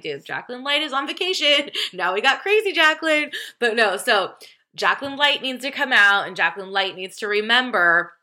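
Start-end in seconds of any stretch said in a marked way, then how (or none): 0.0-3.3 higher mock-sassy reply, laughing at the end; playful sass. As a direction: tease your friend back. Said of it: "I'm like".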